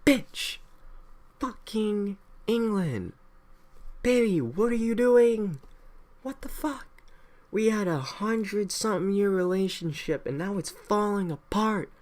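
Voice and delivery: high, slow mock heartbroken partner; jilted lover. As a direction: whine at England like a dumped partner. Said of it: "the Second Continental Congress came together and like".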